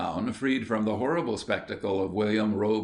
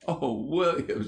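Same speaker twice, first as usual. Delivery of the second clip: laughing; 5 semitones higher than usual. A narrator's reading. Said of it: "laughed till the tears ran".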